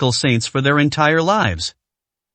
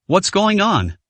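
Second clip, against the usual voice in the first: plain reading voice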